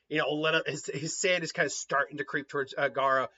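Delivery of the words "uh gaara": usual speaking voice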